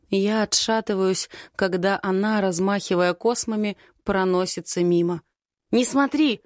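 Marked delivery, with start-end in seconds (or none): 5.7-6.4 sharp high shout of warning. Acted. Artyom shouts at the girl not to look: urgent shout. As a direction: yell it to stop her looking. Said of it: "кричит Артём".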